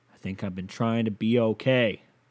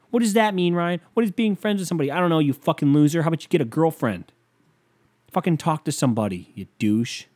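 heated rant; 5 semitones higher than usual. Real, not acted. Snapping back at someone who mocks his friendship, heated. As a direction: fire back at the doubter. Ranting at imagined critic.

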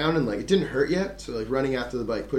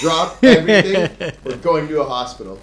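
laughing mock command; 3 semitones higher, 8 dB louder than usual. Urging everyone to the hospital.

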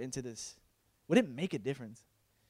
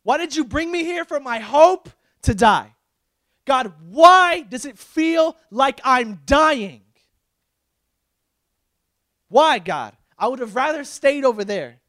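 loud, high pleading shouts; anguished cry to God; 10 semitones higher, 13 dB louder, slower than usual. Acted. Believer ranting at God for bringing them here, anguished shouting. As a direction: cry out with long, stretched key words.

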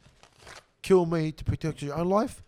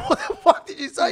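loud, high mock outrage; hammed-up shocked yell; disbelieving outburst; 10 semitones higher, 11 dB louder than usual. Retelling confronting the paranoid friend, mock outraged shout.

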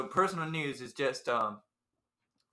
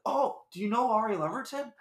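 retold eager question; 8 semitones higher, 4 dB louder than usual. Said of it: "he was like".

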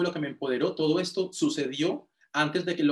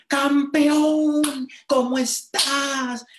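grito agudo y eufórico, alargando las palabras; saludo con chispa; 12 semitones higher, 7 dB louder, much slower than usual. Retold or imitated. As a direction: saluda a todo pulmón, inyectando chispa. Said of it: "siempre me inyectaba esa, esa chispa". (saludo enérgico, fuerte y muy agudo)

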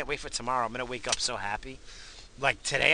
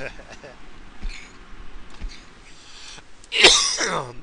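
breathy laugh; 4 dB louder than usual. Real, not acted. Laughing after smoking.

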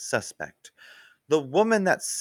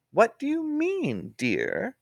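lightly voiced Wiener; 11 semitones higher, slower than usual. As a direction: go up high as the eager trainee. Wiener's high voice.

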